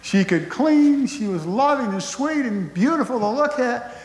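high, hammed-up tearful wail; mock sobbing. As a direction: wail her praises like a comically heartbroken old man. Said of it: "on a street corner sobbing".

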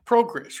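"Progress" high, with a short o. Shown as a demonstration. Host guessing the Brit way, high short-o "Progress". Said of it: "is the Brit way".

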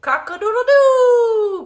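very loud, high, drawn-out crow; long, ringing cock's crow; rooster crowing. Acted. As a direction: crow at full volume like a rooster. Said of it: "crowed the rooster".

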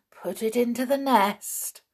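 ordinary delivery, nothing marked

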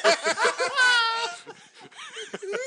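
loud high shrieking laugh; screaming laugh. Real, not acted. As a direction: howl with laughter. Huge laugh at Bobby.

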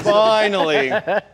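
slow, loud, rising finally; loud, slow cheer; drawn-out finally. Real, not acted. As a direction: stretch it out in triumph. Celebrating women joining.

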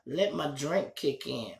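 ordinary talk

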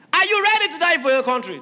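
loud high commander's cry; army commander rallying his soldiers loudly; rallying shout. Acted. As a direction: rouse the troops before battle. Second commander asks again.

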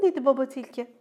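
ordinary delivery, nothing marked

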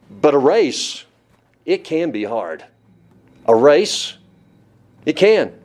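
"race" hit hard, then "can" driven home after a pause; emphatic. Real, not acted. Preacher emphasizes a point to the congregation, punching "race" and "can". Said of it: "let me emphasize this".

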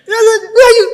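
high, loud, half-laughing; loud puzzled question. Retold or imitated. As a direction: ask it in loud disbelief. Nurse asking loudly, laughing.

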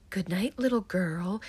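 hushed, eerie bedtime greeting; creepy voice from the dark; creepy intruder. Retold or imitated. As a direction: say it like a stranger hidden in the closet. Intruder whispering from the closet.

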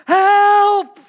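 very high, full-volume drawn-out yell; desperate cry for help. Acted. Person in crisis calling out to God, desperate shout. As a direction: scream for help with everything you have.

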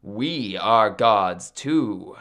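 low, slow, measured retort; defiant young god. Acted. Maui answers his mother back, slow and defiant.

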